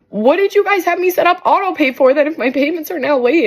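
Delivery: retold exasperation. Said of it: "And I'm like".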